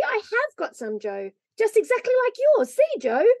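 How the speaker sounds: put-on high-pitched, eager boy's cry; excited boy. A narrator's reading. Davy shows Joe his matching mittens, excited. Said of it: "screamed Davy".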